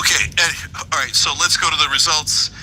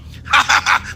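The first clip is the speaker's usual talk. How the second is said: mock evil laugh; 7 semitones higher than usual. Mocking party leaders.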